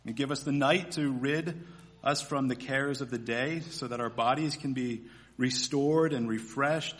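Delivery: ordinary talk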